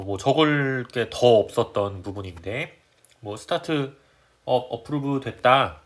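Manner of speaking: usual speaking voice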